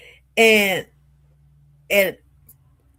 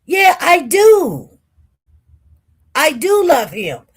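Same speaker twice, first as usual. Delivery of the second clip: emphatic answer; 4 semitones higher, 4 dB louder than usual. Retold or imitated. Retold heated answer to friend.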